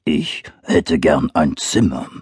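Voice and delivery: low slow bear growl. Acted. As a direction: rumble it like a big bear. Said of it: "brummte der Bär".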